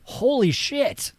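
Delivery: high, drawn-out mock amazement; retold jaw-dropping surprise; astonished. Retold astonishment at actor's real accent.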